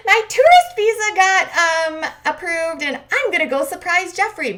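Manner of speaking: very high, loud mock-giddy announcement; excited mimicry. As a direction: squeal the big news, imitating her. Acting out Varya's excited news.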